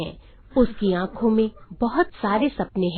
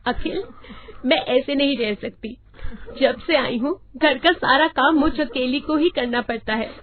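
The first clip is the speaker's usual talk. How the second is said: higher-pitched, mildly weepy wife; tearful complaint; 5 semitones higher than usual. A narrator's reading. Wife to husband about housework, tearfully complaining. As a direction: whine to your husband, lightly acted tears. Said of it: "Myra अपने कमरे में बैठी रो रही होती है".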